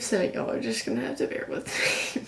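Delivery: choked, near-tearful apology; quietly fighting off tears as she speaks; holding back tears. Real, not acted. Athlete apologising to viewers for getting emotional, choked up. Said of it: "I'm really sensitive".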